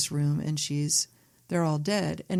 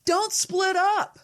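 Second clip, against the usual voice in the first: high-pitched shout at the movie screen; mock yelling; 12 semitones higher than usual. Viewer yelling at horror victims on screen, mock warning. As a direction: call out a hammed-up warning, voice way up. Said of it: "yelling at the screen like".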